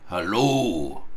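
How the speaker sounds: put-on low, growled old miser's hello; feigned gruff growl. Scrooge pretending to be his old gruff self, growling. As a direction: growl it like a stern old boss. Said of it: "growled Scrooge in his accustomed voice".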